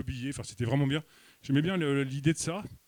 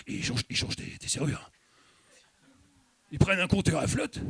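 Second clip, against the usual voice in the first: put-on paranoid old man; 8 dB louder than usual. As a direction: whisper the plot then explode with panic. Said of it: "puis qui vous dit". (vieux paranoïaque, chuchote puis explose en hurlant très aigu)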